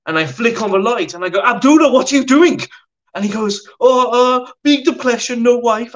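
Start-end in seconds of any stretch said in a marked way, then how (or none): 1.4-2.6 much higher, loud outburst; shocked shout. Retold or imitated. Miles catches Abdullah in the dark room, shocked shout. Catches roommate under sheet. 3.8-5.9 high, loud flustered pidgin; sheepish broken-English excuse. Retold or imitated. Caught Abdullah excusing himself to Miles, flustered broken English.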